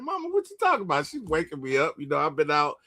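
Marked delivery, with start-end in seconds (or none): 0.0-1.1 high, fast and bewildered, just woken up; groggy high-pitched confusion. Retold or imitated. Son just woken by mom, high and confused. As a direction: play the dazed son squeaking up in disbelief. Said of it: "I was like".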